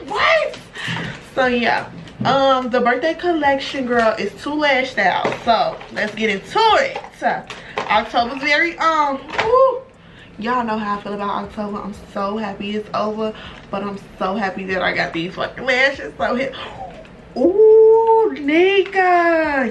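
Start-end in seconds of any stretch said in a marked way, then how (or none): 0.1-0.6 high, loud disbelieving shout; shocked shout. Retold or imitated. Vlogger retelling her shock at the surprise note, shouting. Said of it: "I'm like". 17.4-19.7 loud, high squeal of delight; excited squeal. Real, not acted. Vlogger thrilled by her friend's birthday lashes, squealing.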